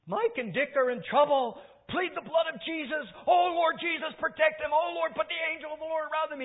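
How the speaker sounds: desperate shouted prayer, pleading for protection; panicked pleading prayer. Retold or imitated. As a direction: shriek it out as a terrified mother praying for her sons. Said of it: "she started having panic attacks".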